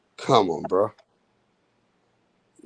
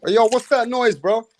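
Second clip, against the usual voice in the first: loud irritated call-out, higher and louder; annoyed shout; 5 semitones higher, 4 dB louder than usual. Real, not acted. Host yelling at a noisy chat guest, annoyed shout. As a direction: snap at the noisy guest to knock it off.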